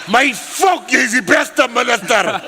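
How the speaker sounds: loud, high imitated outburst; excited exclamation. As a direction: shout the praise in mock amazement. Retells Danny's excited outburst.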